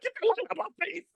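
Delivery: high, fast hammed-up squeal; mock snap. Retelling how Risha fussed at the camera, high hammed-up squeal. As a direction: shriek it like a fussy friend. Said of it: "he was like".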